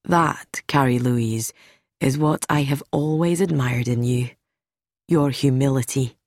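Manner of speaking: low, gentle sincerity. Acted. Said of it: "Doctor Galbraith said gently".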